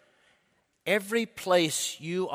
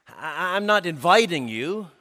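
ordinary delivery

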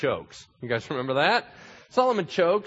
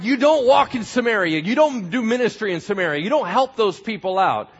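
loud, high commands; forbidding leaders; 10 semitones higher, 10 dB louder than usual. Acted. Leaders forbidding Jesus to go to Samaria: loud scolding. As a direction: lay down the law.

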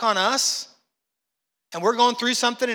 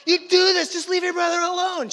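loud, high, slow scolding shout; mother bursting in to scold the older brother; exasperated mom; 10 semitones higher, 6 dB louder than usual. Retold or imitated. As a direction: yell at the kid like you've had it.

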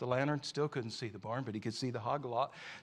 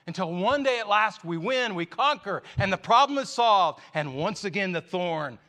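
louder and higher with each phrase; rising triumph; 7 semitones higher, 11 dB louder than usual. Real, not acted. Preacher to congregation, swelling into a triumphant cry. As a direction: build to a ringing shout of victory. Preacher's building climax of victory.

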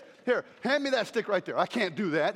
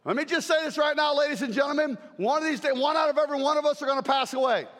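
raised, high preaching voice; 4 semitones higher than usual. Real, not acted. Preacher warning congregation, full-force preaching. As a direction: proclaim it to the room.

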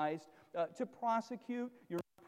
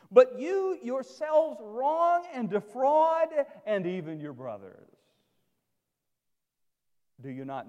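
forceful scripture reading; 6 dB louder than usual. Acted. Pastor reading Corinthians to the congregation, forceful and emphatic. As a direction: read it out to the church like a rebuke.

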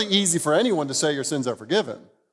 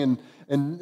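normal talking voice

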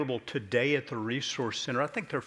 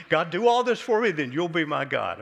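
much higher, louder mocking plea to God; mock demand; 6 semitones higher, 7 dB louder than usual. Preacher mocking Jacob's deal with God, hammed-up demand. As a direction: send up a pushy deal with God.

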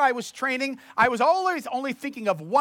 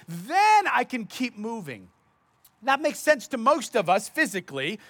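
ordinary talk, nothing marked

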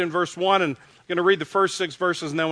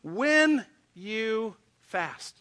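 very slow, every word stretched; slow emphatic quote; 2 semitones higher, much slower than usual. Acted. Pastor quoting Jesus to the congregation, slow emphatic. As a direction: lay each word out slowly for weight.

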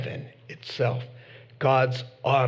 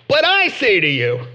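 higher and louder declaration; authoritative proclamation; 6 semitones higher, 6 dB louder than usual. Acted. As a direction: announce it with authority. Voicing Jesus' teaching.

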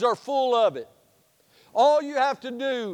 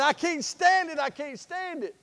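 high, bursting with excitement; breathless eager kid, voice pitched up; excited; 6 semitones higher than usual. Retold or imitated. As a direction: mimic a kid who can't wait. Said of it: "He was so excited".

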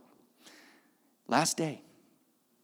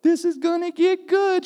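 high, loud delighted aside; gleeful anticipation; 9 dB louder than usual. Retold or imitated. Wife watching the dinner turn lively, gleeful. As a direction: relish the coming show.

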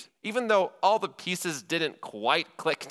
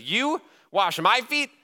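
slow, high mock disbelief at the idea; incredulous Peter; 8 semitones higher, 5 dB louder, slower than usual. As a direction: drag it out, appalled that his teacher would stoop. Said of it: "He basically says".

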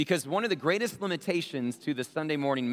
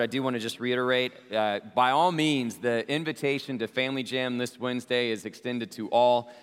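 normal talking voice

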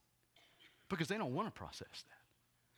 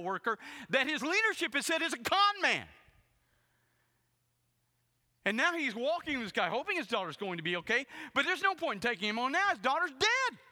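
higher and louder, driving preacher voice; preaching at full force; 12 semitones higher, 9 dB louder than usual. Acted. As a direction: build the sermon to a fervent peak.

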